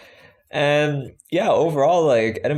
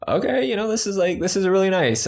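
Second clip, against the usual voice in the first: high, impressed reply; 8 semitones higher than usual. Retold or imitated. Retold own reaction.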